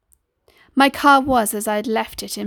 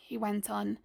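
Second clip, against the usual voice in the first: ordinary delivery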